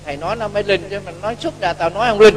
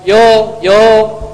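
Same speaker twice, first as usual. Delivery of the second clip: loud, punchy cheer to drink; mock drinking toast; 2 semitones higher, 7 dB louder than usual. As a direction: call out a toast to the table. (tiếng hô cạn ly thật to)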